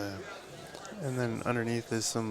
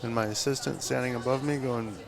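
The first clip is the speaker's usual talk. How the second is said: normal talking voice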